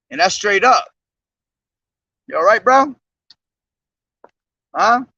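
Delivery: ordinary talk, nothing marked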